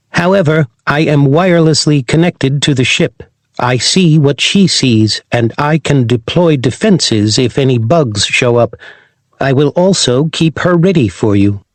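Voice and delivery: lightly voiced robot companion; a hint of a polite robot dog. Robot Corgi character voice.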